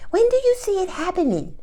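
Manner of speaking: squeaky high impatient fan; timeline junkie voice. Acted. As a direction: pester for a date like an impatient fan. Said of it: "That's my timeline junkie voice".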